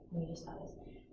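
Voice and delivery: whisper. Shown as a demonstration. Teacher whispers the reminder to the class, softly. Teacher echoes students' thinking.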